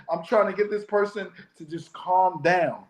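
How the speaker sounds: normal talking voice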